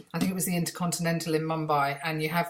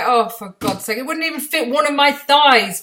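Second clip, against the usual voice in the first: exasperated; 7 semitones higher, 8 dB louder than usual. Retold or imitated. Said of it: "I'm like".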